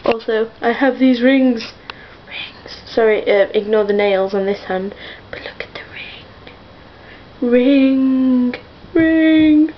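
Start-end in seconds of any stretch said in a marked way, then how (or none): 2.3-2.8 whisper. Pretend. Whispered show-and-tell. 5.3-7.5 whisper. Pretend. Whispered show-and-tell. 7.5-9.7 playful call. Pretend. Silly repeated word, rising.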